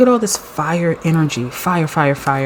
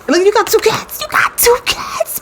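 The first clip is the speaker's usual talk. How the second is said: much higher, squealing with delight; thrilled high-pitched yelp; excited squeal. Real, not acted. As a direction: squeal it out in disbelief. Excited discovery of matching cards.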